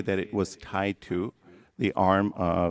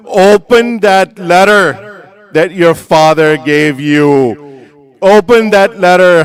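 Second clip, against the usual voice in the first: loud, higher preaching voice; impassioned mother's plea; urgent motherly command; 8 semitones higher, 14 dB louder than usual. Acted. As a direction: urge it strongly, raised and insistent. Mother urgently tells son to open letter.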